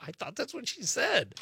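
high-pitched, defensive protest; defensive friend. Retold or imitated. Friend excusing his mishearing, defensive. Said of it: "And he's like".

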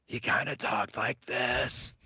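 slow, strained hoarse croak; lost voice. Retold or imitated. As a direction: imitate a bandmate who has lost his voice. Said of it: "he completely lost his voice".

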